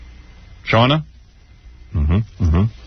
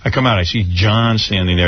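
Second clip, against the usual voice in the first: normal talking voice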